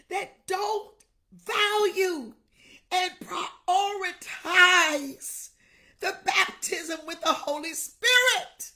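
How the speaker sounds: loud, high preaching shout with tongues; full-force preaching. Real, not acted. Pastor rebuking those who mock tongues, shouting. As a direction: rebuke the mockers at the top of your voice.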